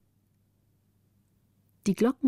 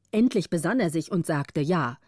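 plain reading voice

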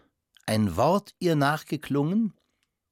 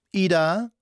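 raised voice call; 4 semitones higher, 4 dB louder than usual. A narrator's reading. The mother calls the maid into the dining hall, raised voice. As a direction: call the name out, higher and louder. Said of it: "rief sie mit erhobener Stimme".

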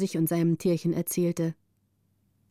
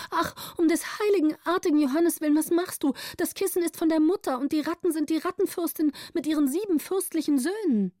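put-on high, fast pleading girl's voice; 8 semitones higher, faster than usual. A narrator's reading. Gritta begs the countess to spare the rats, high pleading. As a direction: beg in a high girlish voice.

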